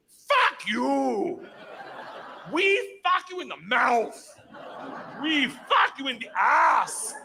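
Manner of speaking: gangster yelling. Retold or imitated. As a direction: shout it at the cop, dragged out. Russian gangster yells at cop.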